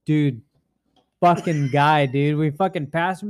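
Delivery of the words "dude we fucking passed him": ordinary delivery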